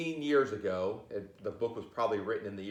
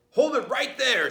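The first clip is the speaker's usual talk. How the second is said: much higher and louder halt; police command; 14 semitones higher, 9 dB louder than usual. Acted. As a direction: stop the crooks in the headlights. Police stop the burglars.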